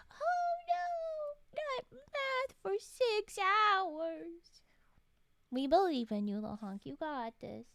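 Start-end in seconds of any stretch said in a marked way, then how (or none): 0.1-4.6 high whispered mock despair, very slow; mock dismay. Teasing a chatter about hours of math in mock dismay. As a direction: drag out the fake horror.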